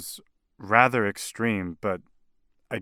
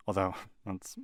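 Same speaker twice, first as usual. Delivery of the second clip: soft chuckle of disbelief; amused, quiet scornful aside; scoffing laugh; 2 semitones lower, 9 dB quieter than usual. Acted. Client scoffs at human intelligence.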